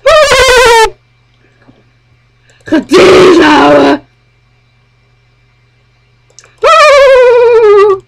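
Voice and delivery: high, loud mocking oohs; jeering cheer. Real, not acted. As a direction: crow over a burn. Cheering after dissing in game.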